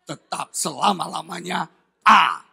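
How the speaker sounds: loud, slow, stubborn decree; angry wife. Retold or imitated. Wife refuses any restaurant but A, angry and emphatic. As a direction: lay it down loudly, word by word. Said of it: "Marah tuh".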